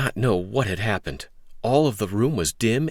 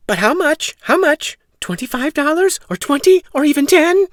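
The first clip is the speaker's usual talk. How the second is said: high, loud pressing mother; eager mother; 8 dB louder than usual. Acted. Mother demanding the price from Jack, high and loud. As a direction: press him eagerly for the sum.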